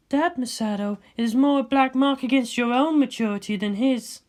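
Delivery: a cool scientist ribbing her friend; Ritsuko's voice. Acted.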